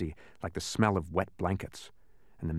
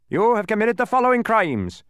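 high, loud formal accusation; officious charge-reading; 13 semitones higher, 7 dB louder than usual. A narrator's reading. Policeman charging Milo, officious proclamation.

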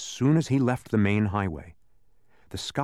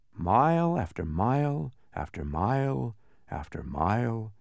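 ordinary delivery, nothing marked

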